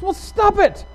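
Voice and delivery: exasperated high shout; high-pitched comic outburst; skit therapist's yell. Retold or imitated. Therapist yells again in retold skit.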